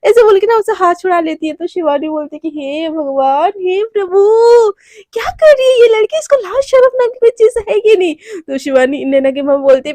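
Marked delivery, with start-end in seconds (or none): 2.6-8.2 exasperated dismay. Acted. Shy bride scolds Naina.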